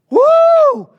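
very high, loud whooping exhale; mock whoop. Preacher mocking how hard the healing was, hammed-up whoop. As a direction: hoot like you just ran a marathon. Mock exhaustion after no work.

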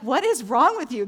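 loud, exasperated motherly cry; a mother's loud exasperated cry; exasperated outburst. Retold or imitated. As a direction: let the alarm burst out at your son. Mother reacting to son's injured eye.

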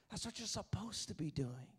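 the refrain dropped soft and low; That's what you're supposed to be doing, soft and low; soft preached refrain. Acted. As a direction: bring it down to a hush. Refrain repeated softly after peak.

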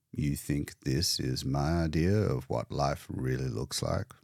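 put-on low, sardonic old man's grumble; gloomy old painter. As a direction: scorn for the orderly, low and soft.

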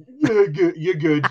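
loud mock reassurance. Mock-forgiving a friend.

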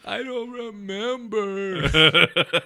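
high, very slow, laughing; a child's whiny excuse, laughed out; laughing kid voice. Retold or imitated. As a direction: mimic the kid through laughter.

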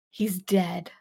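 low, hushed, solemn; soft, dramatic death announcement; mock-grave pronouncement. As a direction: deliver the bad news with pretend gravity. Acting out Bones's pronouncement.

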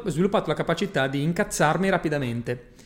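ordinary delivery

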